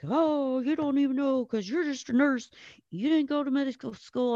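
high-pitched mock of a condescending doctor. Doctor sneering at the nurse who corrected him, high mocking voice.

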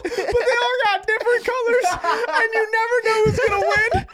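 high-pitched, helpless laughter through the words; laughing. Real, not acted. Friend retelling his roommate's horse racing pitch, laughing helplessly. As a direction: barely get the story out for laughing.

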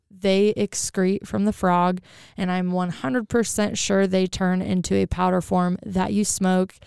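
ordinary delivery

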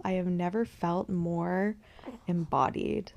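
normal talking voice

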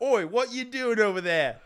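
hammed-up Cockney rat calling out; mock Cockney rat. Friend voices the pet rat taunting the snake, mock Cockney. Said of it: "the rat had the Cockney accent".

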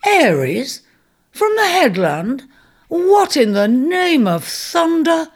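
stunned to find a king in his cave; astonished centaur. Acted.

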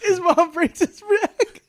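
cracking up mid-sentence; words squeezed out through laughter; laughing. Real, not acted. Laughing while retelling.

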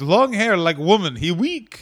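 put-on comic Russian accent; mock Russian heel. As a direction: sneer like a cartoon Russian villain. Other host joins Russian bit.

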